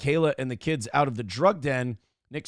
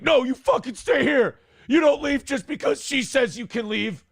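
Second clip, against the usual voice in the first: much higher and louder, furious order; angry shout; 9 semitones higher, 4 dB louder than usual. Retold or imitated. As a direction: yell it at him to stay put. Nick angrily ordering him to stay.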